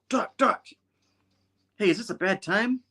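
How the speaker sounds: high-pitched, light call to Doc; calling at window. Retold or imitated. Spidey calls at the window.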